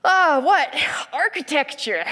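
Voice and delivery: high, loud, gushing disciple; exaggerated high-pitched admiration; mood-lifting enthusiasm. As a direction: play an awestruck tourist, hammed up. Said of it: "wanting to bring up the mood".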